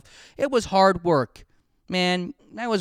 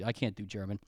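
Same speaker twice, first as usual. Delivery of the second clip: usual speaking voice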